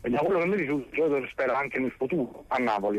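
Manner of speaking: ordinary talk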